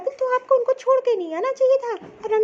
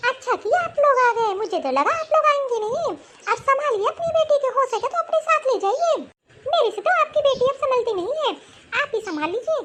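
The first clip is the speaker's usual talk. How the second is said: higher-voiced, complaining mother-in-law; scornful mother-in-law; 3 semitones higher than usual. Mother-in-law telling visitors to take her away, scornful. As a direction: play a fed-up mother-in-law in a raised pitch.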